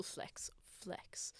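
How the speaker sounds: whispered, slowly sounding out the word; hushed, slow word rehearsal. Real, not acted. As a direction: whisper it to yourself as you work it out. Muttering a word to herself.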